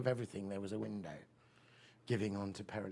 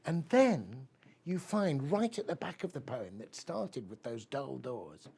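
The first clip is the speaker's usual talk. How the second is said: normal talking voice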